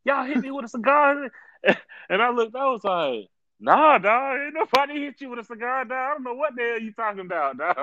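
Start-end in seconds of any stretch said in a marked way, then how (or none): 0.0-1.3 high, complaining voice of the cyclist; accusing local. Retold or imitated. 3.6-7.8 high, mock-innocent brush-off; innocent denial. Narrator denying to the cyclist.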